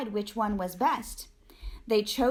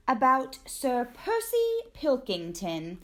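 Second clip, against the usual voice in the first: usual speaking voice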